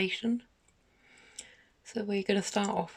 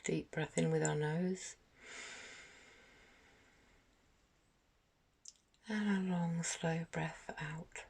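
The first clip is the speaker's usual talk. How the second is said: guided meditation; 3 dB quieter than usual. Acted. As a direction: gently guide them through each breath, unhurried, letting phrases settle. Leads a guided meditation for children.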